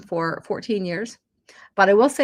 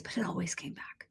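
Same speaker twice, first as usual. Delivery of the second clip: whispered; 3 semitones lower, 15 dB quieter than usual. Real, not acted. Trailing aside.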